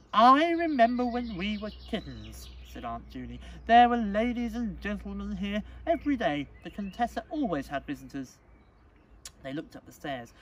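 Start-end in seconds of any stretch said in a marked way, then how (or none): 0.1-2.5 high, loud, gushing aunt; reminiscing aunt. Acted. 3.7-8.4 high, loud, gushing aunt; reminiscing aunt. Acted.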